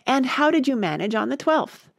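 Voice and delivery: eager question. A narrator's reading. Alice questioning the Mock Turtle about lessons, eagerly. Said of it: "Alice went on eagerly".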